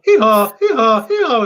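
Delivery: imitated donkey call; donkey bray. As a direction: do a donkey slagging someone off. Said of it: "is he slagging you off? You go".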